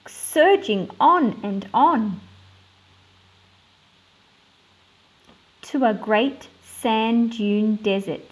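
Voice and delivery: put-on measured read-aloud storytelling for children; story narration. Teacher reading a picture book to her class, storytelling voice.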